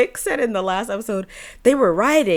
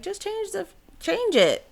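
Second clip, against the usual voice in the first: exasperated; 10 semitones higher than usual. Retold or imitated. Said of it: "I'm just like".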